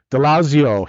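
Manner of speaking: high, loud twangy call of DeLazio, imitating the coach; imitating a twang. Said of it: "Dakich has got a twang".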